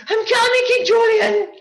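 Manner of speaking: very high, loud, throaty squeak; Mort voice. Shown as a demonstration. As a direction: squeeze it from the throat. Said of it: "it's lots more in the throat".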